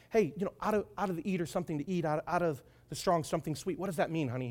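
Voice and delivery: soft, lightly acted wheedling; coaxing. Wife trying to get the answer from Samson, coaxing. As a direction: coax casually, keeping it quiet. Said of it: "she tries to coax Samson".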